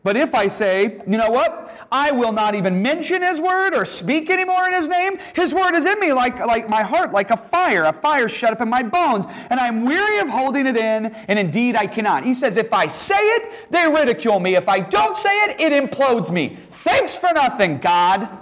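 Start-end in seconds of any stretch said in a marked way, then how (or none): 0.0-12.2 impassioned, high-pitched complaint; Jeremiah. Acted. Weary prophet complaining to God, impassioned. 12.6-18.3 exasperated, very high-pitched protest; Jeremiah. Acted. Prophet blaming God for his bind, exasperated.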